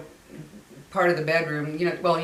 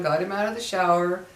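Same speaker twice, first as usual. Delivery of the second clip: normal talking voice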